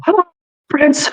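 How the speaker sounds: high, sweet cooing to a pet; high cooing greeting. Acted. Owner greeting his lost cat in high coo.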